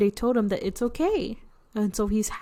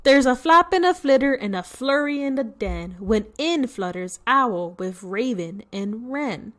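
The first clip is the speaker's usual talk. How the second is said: storybook rhyme; 2 semitones higher, 3 dB louder than usual. A narrator's reading. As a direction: bounce through the verse. Rereading the rhyme faster.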